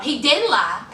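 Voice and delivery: usual speaking voice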